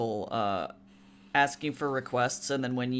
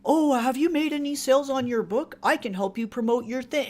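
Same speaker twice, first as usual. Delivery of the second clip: high, peppy marketer voice; chirpy spam bot, pitched way up; fake bot voice; 10 semitones higher, 4 dB louder than usual. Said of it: "the bots on Wattpad are like".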